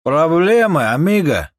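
loud, high threatening call; tough shout. Acted. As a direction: bark a threat at the intruder. Thugs confront.

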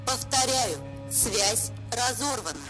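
faint, high, sing-song corporate announcement; like a fading broadcast through static; radio voice. Acted. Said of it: "Он напоминал голос из радиоприёмника". (голос из радиоприёмника)

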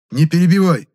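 high, loud reprimand; exasperated outburst; irritated shout. A narrator's reading. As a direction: shut down the interruption. Interrupted narrator snaps back.

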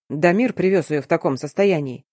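ordinary delivery, nothing marked

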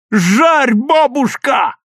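high, stretched-out rallying shout; loud, excited urging; yelling. A narrator's reading. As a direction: holler her on at full pitch. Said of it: "орал Лёвка".

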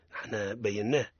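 normal talking voice

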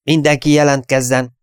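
captain calling for everyone to report; barked order. A narrator's reading. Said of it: "kiadta a parancsot".

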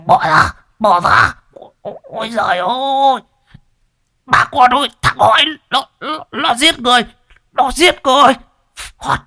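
high, strangled screaming for help; old man choked by a yoke, screaming to the neighbours; choking cry for help. A narrator's reading. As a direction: yell for help while being choked. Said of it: "gầm lên như là cái máy cày".

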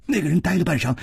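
plain reading voice

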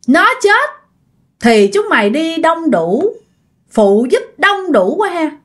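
high, eerie spirit talking through a woman; possessed spirit voice. Acted. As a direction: speak as the vengeful ghost inside her. Possessed woman speaks through Ngọc. (giọng vong nhập cao và rợn)

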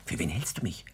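hushed, secretive whisper. Acted. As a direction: whisper it so no one else hears. Secret aside to a woman.

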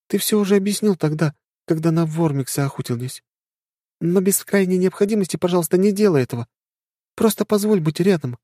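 high, gentle plea; pleading young woman. Acted. Anya pleads.

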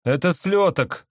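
high, slow know-it-all voice; tourist voice. A narrator's reading. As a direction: say it slowly and high. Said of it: "сказал другой турист".

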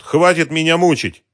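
high, loud exasperated plea; groaning complaint. A narrator's reading. Operator begging the detective to stop, exasperated loud moan. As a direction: moan at being tormented. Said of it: "простонал тот".

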